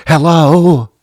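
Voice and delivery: high, loud alarmed hello into the phone; frantic hello. Retold or imitated. Woken by a late call, answering in a frantic panic. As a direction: answer like disaster has struck. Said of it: "in a frantic, frantic hello".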